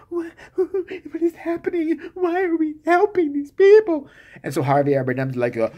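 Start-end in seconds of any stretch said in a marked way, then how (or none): 0.1-4.1 much higher, hammed-up alarmed wife; shrill mock fright, slowing on what is happening; mock panic. Wife freaks out about the strangers, mock panicked and high.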